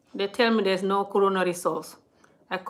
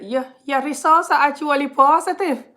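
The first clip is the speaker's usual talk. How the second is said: loud, raised-pitch imitation Scottish accent; Scottish woman impression; 7 semitones higher, 7 dB louder than usual. Scottish hospital woman giving the patient test results, mock Scottish accent. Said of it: "This hospital was a Scottish woman".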